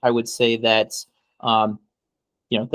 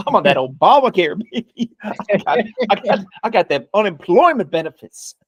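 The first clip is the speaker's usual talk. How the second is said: bragging bigot; 7 semitones higher, 4 dB louder than usual. Acted. Plays the racist shopkeeper bragging.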